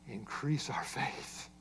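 reverent soft read. Shown as a demonstration. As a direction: read "Increase our faith" as a quiet, devout prayer. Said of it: "This sounds so spiritual".

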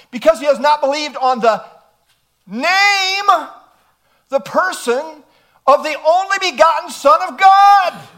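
loud and high, building to a shout; full-force pulpit shout on the Son of God; preaching climax. Real, not acted. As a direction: drive it home to the congregation.

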